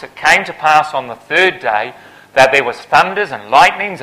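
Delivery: ordinary delivery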